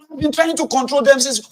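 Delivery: high, loud, defensive reply in the wife's voice; wife shouting back defensively; mimicking the wife. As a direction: play the wife snapping back. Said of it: "take the rage on their mom".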